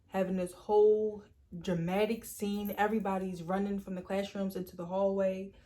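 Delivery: ordinary delivery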